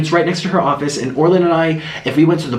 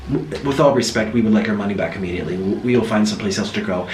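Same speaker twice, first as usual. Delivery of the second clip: low stiff demand; 8 semitones lower, 4 dB quieter, faster than usual. Retold or imitated. French man demands refund.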